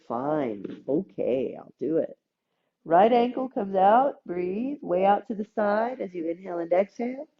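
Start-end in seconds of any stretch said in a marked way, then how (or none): 0.1-2.2 low, softer reluctant giving in; grudging body. Acted. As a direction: mumble it like a body that finally gives up. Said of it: "and the body will say".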